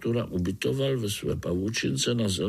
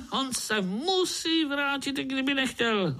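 menacing overseer. A narrator's reading. Overseer tells spider that Artur must return, menacing. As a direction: insist on it in a strained high voice. Overseer scoffs after a laugh.

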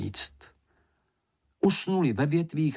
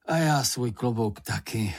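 ordinary delivery, nothing marked

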